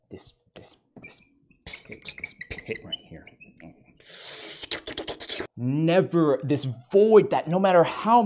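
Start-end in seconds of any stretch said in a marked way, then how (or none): 0.1-3.3 hushed, breathy horror whisper; spooky whisper. Acted. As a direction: whisper it like a ghost story.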